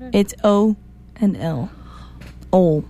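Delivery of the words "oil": "Oil" said as one syllable, like "ol"; Southern single-syllable "Oil"; Southern pronunciation. Shown as a demonstration. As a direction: clip "Oil" down to O and L. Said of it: "oil has two letters down here".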